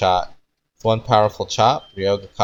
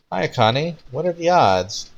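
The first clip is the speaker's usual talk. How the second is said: lightly voiced Ryoga; 8 semitones higher than usual. Ryoga greeting Akane, voiced higher.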